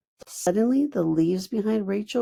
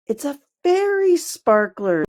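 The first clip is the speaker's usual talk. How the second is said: bright, high and louder with excitement; a fairy thrilled by her idea; excited; 8 semitones higher, 5 dB louder than usual. A narrator's reading. Fern bursts out to the girls, excited. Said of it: "said Fern excitedly".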